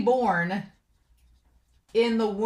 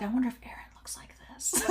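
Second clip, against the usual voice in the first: breathy whisper with a laugh in it; sly whisper; 2 semitones higher, 13 dB quieter, faster than usual. Retold or imitated. Reaction video viewer voicing Floch's sly aside, whispered with a giggle.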